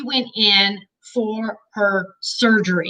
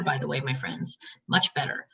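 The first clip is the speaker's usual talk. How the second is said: hushed aside; 9 semitones lower, 10 dB quieter than usual. Real, not acted. Joking aside about breath.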